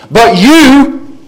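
"you" pitched high and punched; emphatic YOU. Shown as a demonstration. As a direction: hit "you" like capital letters. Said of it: "capital letters with exclamation points".

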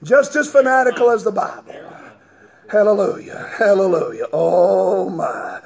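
usual speaking voice

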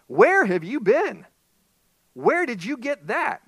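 high, loud, slow surprised cry. Acted. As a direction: gasp it out in shock, drawn out. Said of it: "the surprise in her voice".

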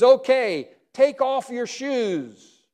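God solemnly instructing Moses; slow solemn command. Acted. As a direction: stretch every word, solemn. God's command voiced.

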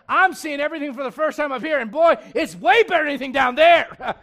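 loud, high, thrilled boast; exultant. Acted. Blind brother seeing for the first time in glory, exultant. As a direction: build to a gleeful shout.

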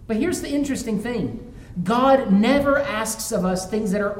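loud, high pulpit preaching; sermon climax delivered at full force. Acted. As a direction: preach it out to the congregation.

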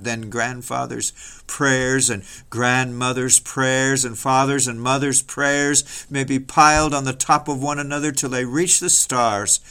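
ordinary delivery

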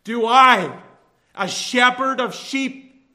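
rising impassioned sermon; preaching. Acted. Preacher builds to climax.

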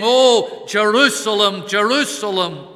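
loud pleading call, fading on the repeat; pleading cry. Acted. Preacher voicing the Lord's plea over Jerusalem, loud pleading cry. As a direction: cry out the plea, then let it soften. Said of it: "he's crying out with this plea".

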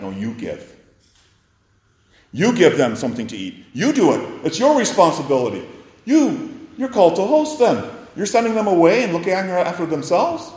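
strong commanding words. Retold or imitated. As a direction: lay the duty on them, forceful. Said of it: "Those strong words".

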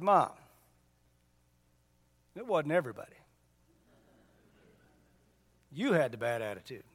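quiet daughter. Retold or imitated. Daughter quietly corrects her mom.

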